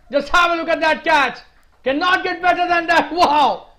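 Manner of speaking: loud, high-pitched commentator scream; real ecstatic roar at a stunning catch; screaming in excitement. Real, not acted. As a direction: shout it from the top of your lungs, stretching the words. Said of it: "I am just screaming out of my lungs".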